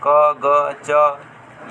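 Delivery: क, ग, च stretched slowly; drawn-out letters. Shown as a demonstration. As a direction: draw each letter out slowly for the class. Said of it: "Harshi, Harshu". (अक्षर धीरे-धीरे खींचकर)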